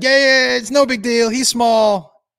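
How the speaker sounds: mock careless owner. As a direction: brush it off like the owner did, half-shouting. Mocking the careless dog owner.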